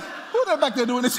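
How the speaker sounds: normal talking voice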